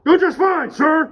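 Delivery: very high, loud parody answer; mock yelled reply. Driver answering the cop, yelled mimicry. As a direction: shout it straight back at the officer.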